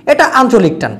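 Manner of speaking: usual speaking voice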